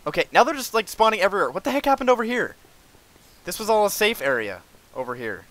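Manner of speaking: high, loud, flustered complaint; alarmed exasperation. Real, not acted. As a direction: protest in disbelief as enemies swarm. Enemies suddenly spawning everywhere.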